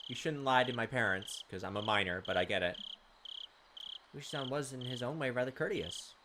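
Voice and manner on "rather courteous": ordinary delivery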